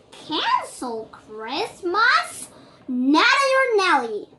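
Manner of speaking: high, loud, slow outraged protest; indignant outcry. Acted. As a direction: refuse the idea at full volume. Cynthia protests cancelling Christmas.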